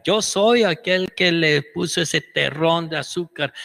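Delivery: high little ant voice; tiny ant voice. Retold or imitated. The ant-sized scientist introduces himself, high tiny voice. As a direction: speak small and high like an ant.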